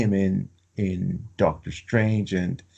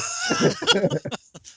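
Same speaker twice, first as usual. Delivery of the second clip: bursts into a high, falling laugh; real laugh at the dark joke; cracking up at the Grim Reaper line. Real, not acted.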